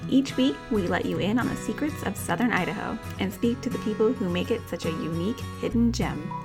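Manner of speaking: upbeat show intro read; podcast host intro. Acted. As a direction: read it like a radio host opening. Podcast intro read over music.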